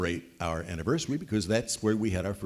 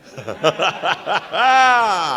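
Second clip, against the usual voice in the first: burst of laughter; 8 semitones higher, 11 dB louder than usual. Real, not acted. Audience laughter at joke.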